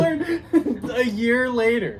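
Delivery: high, loud, laughing through the words; laughing mockery. Real, not acted. Roommate mocking his claim to learn, laughing. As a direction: tease him while cracking up.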